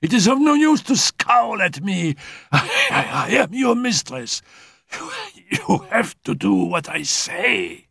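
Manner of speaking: high, breathless invalid's voice; a dying girl insisting she'll live; feverish sick girl. Acted. Dying Annette tells Raoul she will live, high and feverish.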